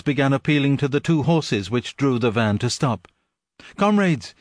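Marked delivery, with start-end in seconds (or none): 3.8-4.3 high, desperate call; pleading shout. A narrator's reading. Animals pleading with the van horses. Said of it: "they shouted".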